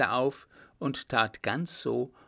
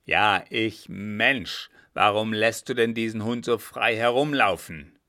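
loud, indignant captain; angry scolding; 8 dB louder than usual. Acted. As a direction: scold him forcefully over the dog. Captain scolds coachman over dog. (laut und empört)

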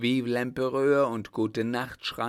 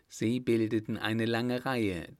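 ordinary delivery, nothing marked

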